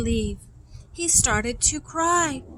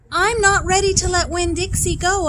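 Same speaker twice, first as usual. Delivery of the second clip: high, louder protest; insistent girl; 6 semitones higher, 5 dB louder than usual. A narrator's reading. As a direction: refuse to let go. Opal insisting.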